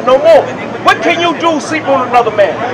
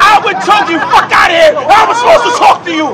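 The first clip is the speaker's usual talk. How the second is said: fast, high angry shouting; furious; 5 semitones higher, faster than usual. Real, not acted. Preacher threatening the critic, furious fast shout. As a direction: rage at him, words tumbling out.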